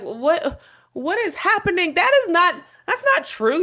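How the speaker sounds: sudden high, loud outburst of disbelief; shocked disbelief. Retold or imitated. Older man hearing pseudoscience, shocked and incredulous. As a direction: blurt it out like you can't believe your ears. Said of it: "Louis is like".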